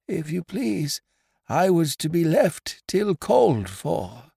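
put-on gently, as the polite old stranger; mildly. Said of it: "said the stranger mildly".

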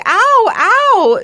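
high, loud "ow" yelps; cry of pain. Retold or imitated. Patient telling of the lidocaine shots, high loud yelps of pain. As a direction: re-enact sharp cries of pain.

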